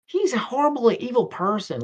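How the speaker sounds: high put-on denouncing voice; mock badmouthing. Sherm badmouths him to a friend, mock outrage.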